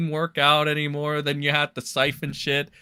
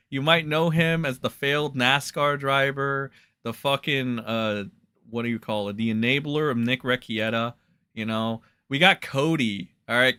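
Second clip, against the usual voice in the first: usual speaking voice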